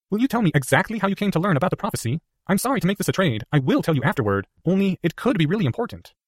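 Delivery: put-on a clever boy weighing his words; earnest schoolboy reasoning; young Harry voice.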